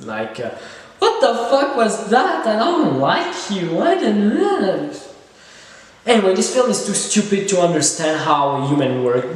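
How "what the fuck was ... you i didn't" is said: high, swooping mock girl voice; hammed-up high-pitched indignation; disgusted girl. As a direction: imitate a girl appalled by a weird text. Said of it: "she won't be like".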